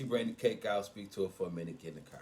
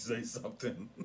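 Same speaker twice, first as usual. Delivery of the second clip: laughing. Real, not acted. Laughs at own rum-cake confession.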